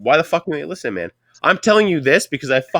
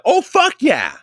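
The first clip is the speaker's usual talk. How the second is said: much higher breathy-free eager woman's voice; lady's voice; 12 semitones higher, 3 dB louder, much slower than usual. Retold or imitated. Imitating the female mechanic answering her boss, much higher woman's voice. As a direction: push it way up like the hire. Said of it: "But it's in a lady's voice".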